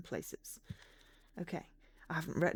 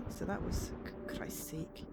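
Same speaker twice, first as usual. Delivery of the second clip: whispered; 3 semitones lower, 6 dB quieter than usual. Real, not acted. Startled in the dark.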